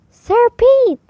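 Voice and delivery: high, loud lady voice calling out; Lady Callie calling to the knight, high and loud; lady's call. A narrator's reading. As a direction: call his name brightly as the lady.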